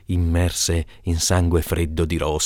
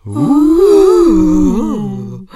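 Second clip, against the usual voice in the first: long, loud, high ghostly "ooh"; horrified wail; 9 dB louder than usual. Acted. Family shuddering at central heating, horrified wail.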